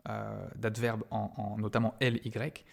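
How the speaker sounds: normal talking voice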